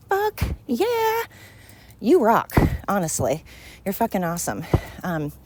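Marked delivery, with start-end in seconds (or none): 0.1-2.5 excited cheer. Real, not acted. Cheering on loyal listeners.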